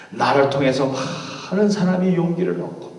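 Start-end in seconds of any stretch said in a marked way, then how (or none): none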